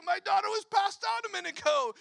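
high, loud and fast; excited retelling. Retold or imitated. Quoting Eddie to the congregation, high, loud and fast. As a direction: rush it out in amazement.

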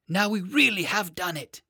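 high, thrilled exclaim; excited. A narrator's reading. Boy telling his horse they've won, high excited voice. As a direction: still bubbling with victory. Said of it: "he cried".

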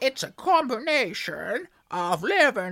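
put-on cheerfully overdone American accent. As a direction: read the letter in a hammed-up American accent. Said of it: "maybe stop doing the accent".

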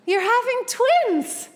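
very high, loud, drawn-out reveal; sing-out surprise, slow and high; big announcement. Retold or imitated. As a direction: imitate a doctor belting out huge news. Doctor's big announcement, acted out.

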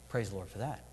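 quiet. Shown as a demonstration.

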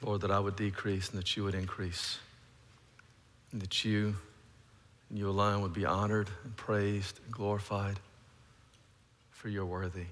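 soft, low prayer voice; hushed reverent prayer. Acted. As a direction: pray it quietly, head bowed. Closing prayer before the sermon.

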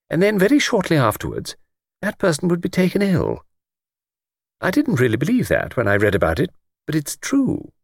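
put-on high, eager elderly lady voice; chatty old lady. Old lady on the train chatting to Luke, high elderly voice. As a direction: play a talkative old lady delighted by a stranger.

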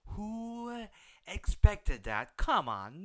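ordinary talk

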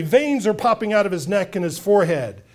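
ordinary delivery

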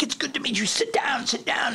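airy, high, hushed British charm; imitating a flamboyant Brit, breathy and high; lightly mimicked posh interviewer; flamboyant English guy. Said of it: "He's like this flamboyant English guy".

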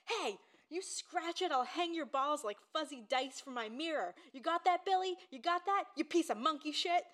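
imitating Kenny the closer yelling at a boy; mock furious threat. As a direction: bark the threat at the kid like a mad salesman. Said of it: "and he says".

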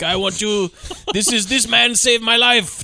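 mock Greek accent. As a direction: try out a comic Greek accent. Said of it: "is it okay to do the Greek accent?".

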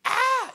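high shriek of fright; mock terrified scream. Elijah fleeing Jezebel's threat, hammed-up shriek. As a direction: yelp like a scared man fleeing. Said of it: "He's like".

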